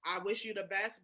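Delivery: usual speaking voice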